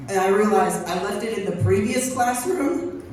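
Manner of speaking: ordinary talk, nothing marked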